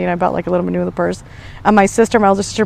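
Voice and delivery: ordinary delivery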